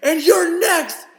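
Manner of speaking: loud, high shouted threat; mom's yell. Retold or imitated. Mother spotting the giggling son after kicking the door in, yelling. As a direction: bellow it like an angry mom catching a giggling kid. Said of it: "she just goes".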